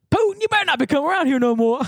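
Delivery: high-pitched Southern holler; mock Southern accent. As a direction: play an indignant Southerner warning off an invader. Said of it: "Joe was talking about Putin invading Georgia".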